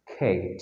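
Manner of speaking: খেট with a gliding "ay", like Kate; long A sound. Shown as a demonstration. Teacher answering the question, খেট with long A. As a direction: say খেট low and soft with a long "ay". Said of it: "এই উচ্চারণ হচ্ছে".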